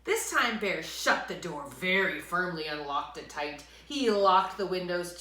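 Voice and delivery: ordinary delivery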